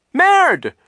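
put-on very high, loud child's shriek; piercing high exclamation. A narrator's reading. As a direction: shriek the single word. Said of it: "Sunny shrieked".

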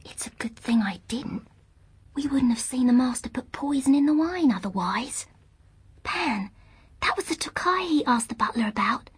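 soft, secretive whisper; hushed conspiratorial whisper; urgent whisper. A narrator's reading. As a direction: whisper an alarming discovery from hiding. Lyra whispering inside the wardrobe.

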